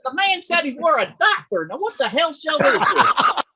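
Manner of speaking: high-pitched, exasperated comic viewer voice; baffled viewer at home. Acted.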